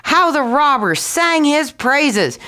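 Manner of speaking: plain reading voice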